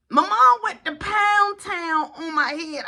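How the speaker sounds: drawn-out complaint. Retold or imitated. Son telling how mom hit him, slow emphatic complaint. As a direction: stretch out "Pound Town" in outrage.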